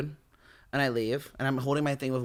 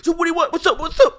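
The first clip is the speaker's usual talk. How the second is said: high, loud, repeated taunts; retold squaring up, pitched way up; loud challenge; 14 semitones higher, 7 dB louder than usual. Rivals shouting across the lunch table, loud challenge.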